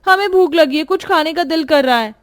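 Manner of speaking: high, whining voice; whiny nanad. Acted. Nanad to sleepy bhabhi, high and whining. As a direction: whine that you're hungry.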